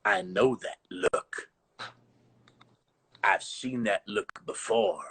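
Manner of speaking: a teacher's low, spooky line to a scared student; low ominous teacher voice. Retold or imitated. Teacher eyeing his student in a garage, low and ominous. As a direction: drop the voice low and slow, menacing. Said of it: "He goes".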